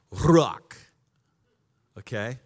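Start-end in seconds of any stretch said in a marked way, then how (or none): 0.1-0.8 ruach with throaty rolled R; Hebrew pronunciation. Shown as a demonstration. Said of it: "okay, here it goes".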